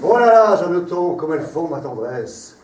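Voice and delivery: grand tragic stage delivery. Acted. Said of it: "sur un ton de tragédie".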